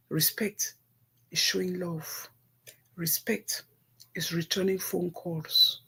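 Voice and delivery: soft earnest definition. Real, not acted. Softly defining respect to viewers.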